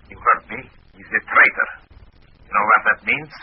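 stern Russian agent; Russian accent. Acted. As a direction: lean in with Soviet menace. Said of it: "in my best Russian accent".

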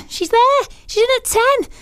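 high, thrilled burst of delight; excited squeal. Real, not acted. Radio host thrilled her favourite made the top ten, excited squeal. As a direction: squeal it out like your favourite just charted.

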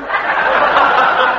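a short scornful cackle at the candidate; mocking laugh. Landlord laughing off the nosy candidate, mocking cackle. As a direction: laugh him off as a nosy troublemaker.

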